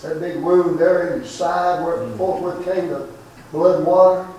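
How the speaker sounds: impassioned street sermon, rising and falling; preaching. Acted.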